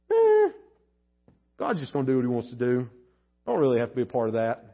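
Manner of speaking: quick, low, offhand brush-off; dismissive shrug. Retold or imitated. Someone shrugging off prayer, offhand and quick. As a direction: toss it off like it doesn't matter. Mock careless non-praying attitude.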